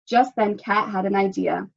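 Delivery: ordinary delivery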